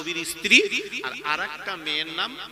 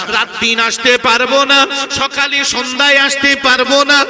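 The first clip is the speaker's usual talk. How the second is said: tearful farewell lament; 3 semitones higher, 16 dB louder than usual. Acted. The Prophet bids the Kaaba goodbye before leaving for Medina, loud tearful lament. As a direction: wail it out as a preacher's sung lament. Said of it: "নবী কাঁদে আর বলে".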